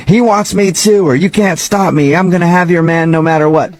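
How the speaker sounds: high-pitched, taunting mock yell. Coaching a fake mistress to taunt his wife, mock yell. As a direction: yell it half-heartedly, pitched well up. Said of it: "you could even yell at her".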